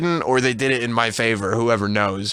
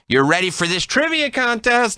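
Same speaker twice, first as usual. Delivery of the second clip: mock announcer reveal; 13 semitones higher than usual. Fake proposal punchline.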